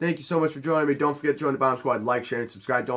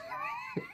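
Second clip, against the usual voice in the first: sudden high laugh; burst of laughter; 16 dB quieter than usual. Real, not acted. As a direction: crack up at your own line. Laugh after own threat.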